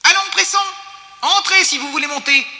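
very high, piercing cry; shrill, loud hurrying call; strident shout. A narrator's reading. Mole hurrying passengers into the lift, strident. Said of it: "cria-t-il d'une voix stridente".

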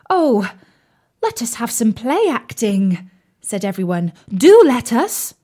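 high, loud eager pleading; excited. Acted. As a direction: beg eagerly all together. Everyone begs to play.

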